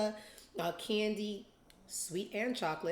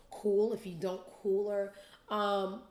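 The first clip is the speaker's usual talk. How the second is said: ordinary talk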